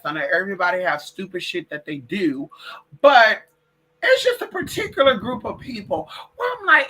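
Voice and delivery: ordinary talk, nothing marked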